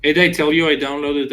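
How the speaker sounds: ordinary delivery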